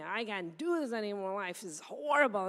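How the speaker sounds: lightly mocked despairing whine; mock whining. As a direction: imitate a believer who has given up. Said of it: "whining and venting".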